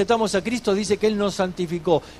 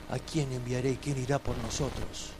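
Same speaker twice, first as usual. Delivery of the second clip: softer, lower, solemn question; hushed divine voice; 7 semitones lower, 14 dB quieter than usual. Retold or imitated. Preacher voicing God calling Isaiah, hushed and solemn. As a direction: let it sound like God speaking from afar.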